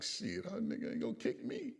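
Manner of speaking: high, scoffing cocky aside. Retold or imitated. Recruit privately dismissing the kickboxing sergeant, cocky. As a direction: sneer it to yourself, unimpressed.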